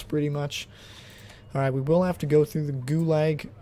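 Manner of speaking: ordinary delivery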